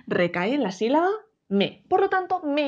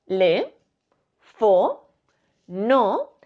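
te, le, fo, no with long gaps; questioning lift on each separate syllable; 2 semitones lower, 3 dB louder, much slower than usual. Shown as a demonstration. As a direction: present each syllable on its own. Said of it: "De esas cuatro sílabas que hay".

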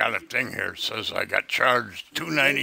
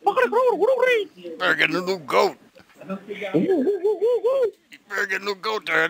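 mocking sing-song; 11 semitones higher than usual. Mocking the scammer.

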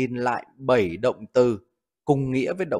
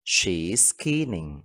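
slow model reading; 5 semitones lower, 5 dB quieter, much slower than usual. Shown as a demonstration. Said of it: "Ví dụ, cô ấy đang khóc tang".

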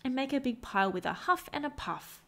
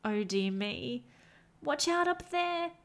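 worried cry; 6 semitones higher, slower than usual. A narrator's reading. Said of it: "good Trevor did cry".

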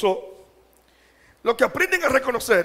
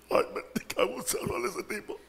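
whispered laughing aside; 2 semitones higher, 9 dB quieter than usual. Real, not acted.

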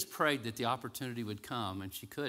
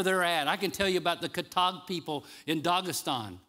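raised, ringing sermon delivery with stretched key words; full-force preaching; 7 semitones higher, 7 dB louder than usual. Acted. Preacher proclaiming God's glory to the congregation, full-force preaching. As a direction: proclaim it higher and louder.

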